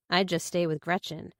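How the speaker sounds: plain reading voice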